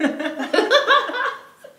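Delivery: high loud laugh; loud burst of laughter. Real, not acted. Loud laugh after punchline.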